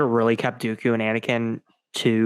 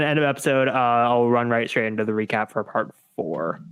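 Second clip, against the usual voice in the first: usual speaking voice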